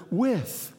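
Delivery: with lifted and set apart; stress on with. Shown as a demonstration. Preacher singling out the word for the congregation, with set apart. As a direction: land on the word "with" as the key. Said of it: "that word".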